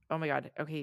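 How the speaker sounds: normal talking voice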